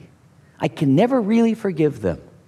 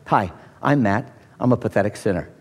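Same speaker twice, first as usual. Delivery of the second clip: mock confession; 9 semitones lower, 4 dB quieter than usual. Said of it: "just greet those around you".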